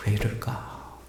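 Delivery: soft unvoiced whisper to himself; whispered thought. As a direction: breathe it out as a private wounded question. Stunned inner thought after hurtful gossip.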